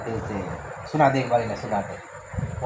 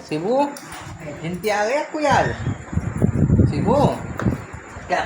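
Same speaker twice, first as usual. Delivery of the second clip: calling out; 8 semitones higher, 5 dB louder than usual. Real, not acted. Father calls son to ask about counting, raised voice. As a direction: call across the room to him.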